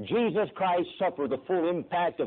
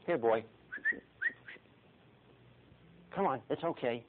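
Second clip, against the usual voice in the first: gentle coaxing; 6 dB quieter than usual. Retold or imitated. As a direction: softly call a wary dog over. Coaxing a wary dog.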